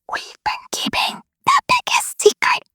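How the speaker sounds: breathy stage whisper of a big secret; dramatic whisper. Acted. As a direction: tease them with the secret. Teasing the biggest secret.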